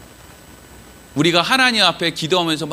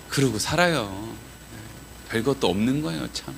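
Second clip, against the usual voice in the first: usual speaking voice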